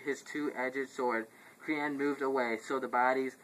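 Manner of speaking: ordinary talk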